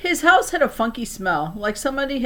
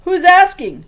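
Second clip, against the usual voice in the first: high, loud suspicious bark; grumpy man answering the door; gruff old man; 11 semitones higher, 12 dB louder than usual. A narrator's reading. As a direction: snap through the cracked door. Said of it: "Mister Lorenz said".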